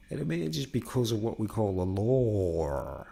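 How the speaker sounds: normal talking voice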